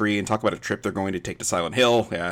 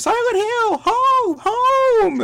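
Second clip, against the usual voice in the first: high mock scream of a child; shrill-pitched hammed-up cry, each word held; imitated scream; 5 dB louder, much slower than usual. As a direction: parody the screaming girl, pitched way up. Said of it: "then Sharon screams".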